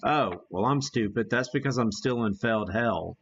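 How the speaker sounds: ordinary talk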